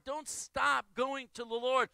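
ordinary delivery

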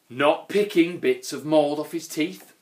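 ordinary delivery

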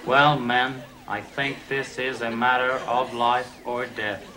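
normal talking voice

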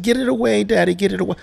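mock a frightened kid begging dad; imitating scared child. Daughter scared of her own drawing, high mock-frightened plea. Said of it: "She's like".

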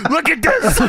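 high, indignant mock shout; mock scolding. Host playing Mongo breaking up an argument, mock indignation. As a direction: scold loudly, imitating an outraged bystander. Acts out Mongo pointing at bodies.

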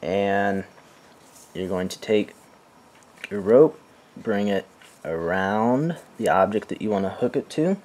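ordinary talk

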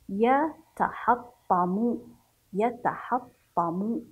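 يتحطم slowly, broken into syllable groups; يتحطم very slow and deliberate, ending on a held vowel; calm, focused reading. Shown as a demonstration. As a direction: sound out يتحطم part by part with pauses. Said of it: "نقرأ بهدوء وبتركيز".

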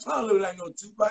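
fast, high protesting shout; mock denial. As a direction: brush it off quickly. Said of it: "They're like".

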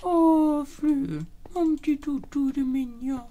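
very high cooing to a pet; baby talk. Acted. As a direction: talk to a puppy. Coos to a dog.

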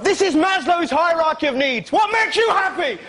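very high, loud mock tester; pompous bloke. A visiting tester quizzing kids, hammed-up high and loud. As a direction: ham up a silly official. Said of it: "Some bloke arrived".

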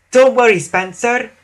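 high, tooting reassurance; Emily. A narrator's reading. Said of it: "tooted Emily".

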